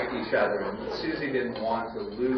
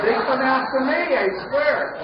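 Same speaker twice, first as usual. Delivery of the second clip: high, loud exasperated plea; pleading protest; 9 dB louder than usual. Retold or imitated. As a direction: protest to the wife that it isn't your fault. Pleading to wife, shouted.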